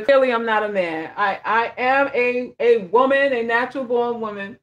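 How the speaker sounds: usual speaking voice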